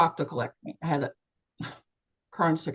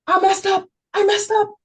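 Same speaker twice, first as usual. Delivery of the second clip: much higher, louder panic; panicked; 6 dB louder than usual. Retold or imitated. Seeing blood pour out, high loud panic. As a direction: cry out in alarm, repeating it.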